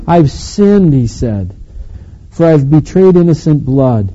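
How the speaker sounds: loud, declaimed Bible passage; emphatic scripture reading. Real, not acted. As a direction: read the scripture out forcefully to the congregation.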